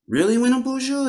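high, loud, puzzled "really?"; confused surprise. Retold or imitated. Grandmother doubts the boy's wish, asking in high, loud surprise. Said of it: "she was kinda confused".

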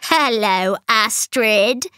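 lightly voiced witch, hello with a faint cackle; a hint of a crone's cackle; cackling witch greeting. A narrator's reading. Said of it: "she cackled".

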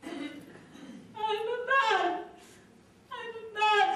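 very high, drawn-out anguished wail; imitating someone breaking down in a confession; imitated wail. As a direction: wail it out slowly, stretching each word. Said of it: "And she begins to wail".

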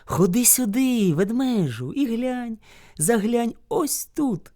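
high, slow, softening lure; coaxing hare. A narrator's reading. Hare lures bear to the well, coaxing. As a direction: beckon him closer slyly.

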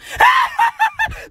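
high shrieking laugh. Real, not acted. Laughing off a love confession, shrieking cackle.

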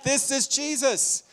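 a pastor driving the point home; preaching. Acted. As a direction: preach it to the crowd, building. Preaching builds about Jesus.

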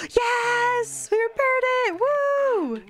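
gleeful cheer; triumphant cheer. Real, not acted. Triumph after repair.

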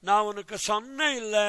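quick, sweet Coimbatore Tamil; lightly voiced Coimbatore Tamil speech; Coimbatore Tamil dialect. Shown as a demonstration. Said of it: "This is how people speak".

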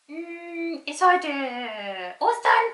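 eager quick guessing; excited puppet. Pretend.

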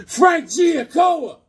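slow, high, shouted name; excited shout. Real, not acted. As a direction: proclaim the name with delight. Excited announcement of inker.

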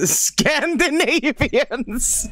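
drawn-out mock sneer. Real, not acted. Mock sneer at chat's reply.

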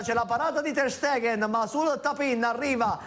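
high, excited goal recap; breathless italian goal commentary. Real, not acted. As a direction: keep the thrill running through the recap.

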